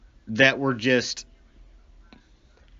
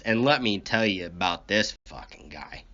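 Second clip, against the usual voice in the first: ordinary delivery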